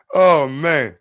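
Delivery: ordinary talk, nothing marked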